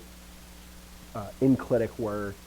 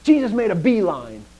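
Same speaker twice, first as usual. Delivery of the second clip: high, loud imitation of a Mississippi teacher; teacherly proclamation, pitched up; emphatic Greek teacher; 9 semitones higher, 10 dB louder than usual. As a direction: punch the verb phrase like an old teacher's catchphrase. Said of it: "it was from Mississippi".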